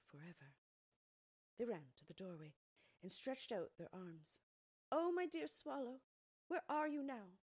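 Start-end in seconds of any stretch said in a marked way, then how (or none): 4.9-7.4 high, loud call to the sky; crying out. Acted. Thumbelina calls out for her swallow, high and loud. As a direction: call out longingly for the lost friend. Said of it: "they cried".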